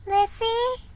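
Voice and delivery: put-on high, small child calling her sister; a child's eager call; little girl voice. A narrator's reading. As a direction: lift the voice up like a young girl. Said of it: "said Nan".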